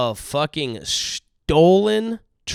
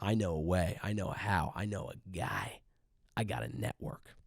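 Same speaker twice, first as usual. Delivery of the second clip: shady buyer; 5 semitones lower, 11 dB quieter than usual. Acted. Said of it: "that buyer's gonna be like".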